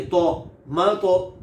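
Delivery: मतो said very slowly with a thick, heavy तो; Arabic tajweed demo of heavy ता; heavy emphatic to. Shown as a demonstration. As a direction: drag out तो in मतो, full-mouthed. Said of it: "तो देखिए".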